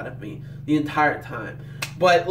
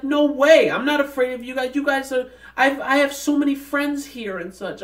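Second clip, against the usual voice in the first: eager denial; 9 semitones higher than usual. Retold or imitated. Child telling the dying librarian she's not afraid, eager high reassurance. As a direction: lightly voice the child insisting she's not scared. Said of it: "And she's like".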